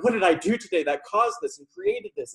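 hammed-up anxious self-blame; teacher mocking a student searching for hidden meaning; mock panicked seeker. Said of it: "And you're like".

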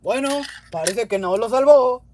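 ordinary talk

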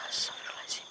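voiceless whispered prayer. Real, not acted. Ghost hunter whispers a prayer before the spirit. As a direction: murmur it under the breath. Praying after seeing the face.

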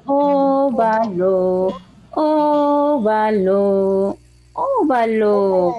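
óvalo repeated very slowly, each syllable apart; slow dictation. Shown as a demonstration. Teacher repeating the word for pupils to write, slow. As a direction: spell óvalo out slowly three times. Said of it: "repeated slow dictation".

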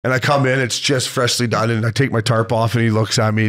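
ordinary delivery

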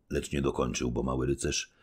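plain reading voice

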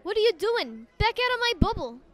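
loud Brooklyn-accented street dog; Scrappy. Shown as a demonstration. Voice actor doing Scrappy on request, loud Brooklyn tough. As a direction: belt it out rough and edgy, Brooklyn accent. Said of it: "do one little impression of Scrappy".

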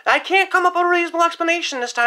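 ordinary talk

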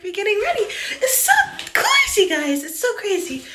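put-on high, squealing bubbly girl voice; TikTok girl voice. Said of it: "I like talking like that girl from TikTok".

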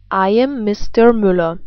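ordinary talk, nothing marked

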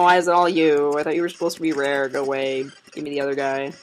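usual speaking voice

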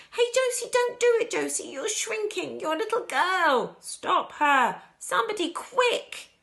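high, loud cries of alarm; panicked shout. A narrator's reading. Grandpa Joe seeing Josie shrink, panicked shouting. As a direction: shout frantically for someone to step in. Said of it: "shouted Grandpa Joe".

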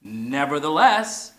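deliberate "Nevertheless" together; slow unison. Shown as a demonstration. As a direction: lead the word slowly. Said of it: "Help me out".

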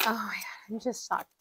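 real whisper, barely breathed; stunned whisper. Real, not acted. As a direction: whisper it in disbelief. Overcome after counting the money.

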